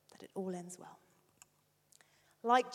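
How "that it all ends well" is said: that it all ends well repeated much softer and gentler; softer echo. Shown as a demonstration. Father repeating the promise softly.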